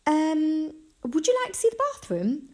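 high, hesitant then hurried question; awkward offer. A narrator's reading. Missus Gates offering the visitor a look round, awkwardly polite. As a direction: stall on "um", then offer quickly, high-pitched. Mum awkwardly changes the subject.

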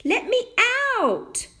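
put-on high, loud tiny boy's cry; teeny tiny shout. A narrator's reading. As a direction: yell in a tiny voice to be freed. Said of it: "shouted Tom's teeny tiny voice".

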